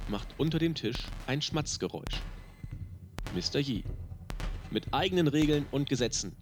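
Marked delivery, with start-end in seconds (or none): none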